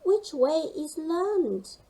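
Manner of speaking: high, slow worried penguin; lost little penguin asking the way; anxious question. Acted. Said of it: "called Gasper".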